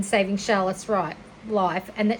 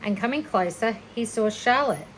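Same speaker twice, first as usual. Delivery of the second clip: usual speaking voice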